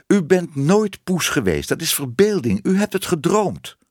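high, exasperated insistence; emphatic insistence. Acted. Man denying she was ever a cat, insistent. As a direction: drive each denial home.

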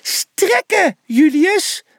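very high little mouse yell; squeaky, high-pitched bellow. A narrator's reading. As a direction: yell the order like a tiny coach. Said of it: "brulde hij".

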